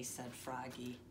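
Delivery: ordinary delivery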